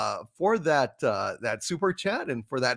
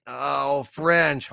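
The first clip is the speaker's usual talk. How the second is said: ordinary talk, nothing marked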